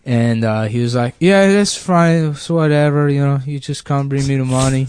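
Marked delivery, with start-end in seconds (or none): 1.2-4.9 put-on Mexican accent. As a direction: imitate an easygoing Mexican landlord. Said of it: "like, Mexican guy showed me the place".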